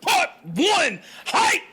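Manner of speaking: slow, high, loud hype yell; hype man shout. Acted. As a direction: hype the crowd up. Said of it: "That was my hype man".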